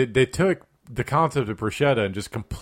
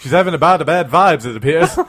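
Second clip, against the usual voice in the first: race announcer; 3 semitones higher, 7 dB louder than usual. Acted. Mock race commentary.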